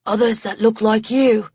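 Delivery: lightly voiced English-accented man; a hint of an English accent, plain telling; light English accent, matter-of-fact. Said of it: "that English accent of his".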